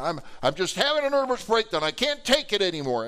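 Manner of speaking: high, loud exasperated outburst; mock-frantic, pushed high; upset, mad. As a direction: act out a woman beside herself. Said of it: "she's upset. She's mad".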